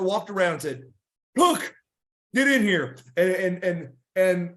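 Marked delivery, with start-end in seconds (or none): 1.4-3.0 dad sharply calling a wrestler in, higher and louder. Retold or imitated. Dad calling Cook into the dressing room, loud call. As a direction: call him in from across the room.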